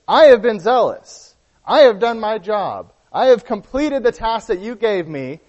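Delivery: aggrieved complaint. Acted. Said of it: "That's what Elijah was saying about the children".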